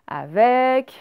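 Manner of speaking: avec said loud and clear with an open è; loud teaching avec; sound demo. Shown as a demonstration. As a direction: hit avec firmly on the è. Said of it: "teacher showing the sound".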